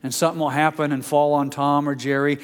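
normal talking voice

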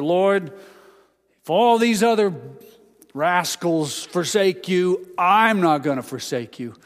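loud, high boast; Peter's loud, proud promise to Jesus; boastful vow. Retold or imitated. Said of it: "Simon Peter before said".

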